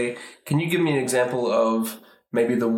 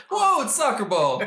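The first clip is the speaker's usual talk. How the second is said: laughing, high surprise. Retold or imitated. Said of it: "find something in the room".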